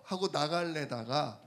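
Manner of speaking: ordinary talk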